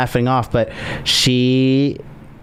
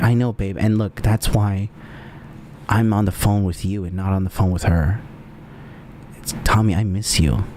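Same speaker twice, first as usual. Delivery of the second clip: tender; 5 semitones lower, 4 dB quieter than usual. Pretend. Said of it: "And I'm like".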